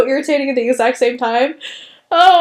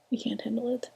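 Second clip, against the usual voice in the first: whispered; 3 semitones lower, 16 dB quieter, faster than usual. Real, not acted. Exasperated aside about unreasonable people.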